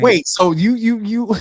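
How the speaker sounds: real stutter, repeating the word. Real, not acted. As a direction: stumble over the words. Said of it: "I'm stuttering".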